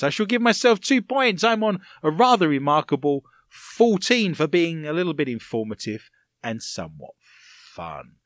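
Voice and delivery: usual speaking voice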